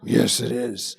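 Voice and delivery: low put-on voice, insisting; mock stubborn actor's low reply. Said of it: "That's not a higher voice".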